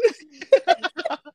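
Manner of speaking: high, loud burst of laughter. Real, not acted. Laughing with friend after silly song, loud cackle. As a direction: crack up at the joke.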